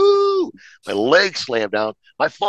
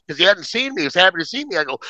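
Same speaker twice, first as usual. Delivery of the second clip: usual speaking voice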